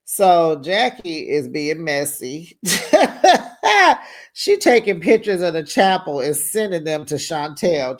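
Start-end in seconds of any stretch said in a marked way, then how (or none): 2.6-4.1 bursts out in high laughter; a real loud laugh. Real, not acted. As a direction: cackles loudly. Laughing at Jackie being messy.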